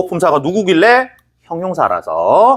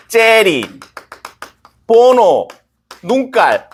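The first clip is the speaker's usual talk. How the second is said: a drawn-out, higher mock gangster growl; mock movie thug; 6 semitones higher, much slower than usual. Teacher acting out the movie thug for students, hammed-up. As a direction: hams up the thug line word by word.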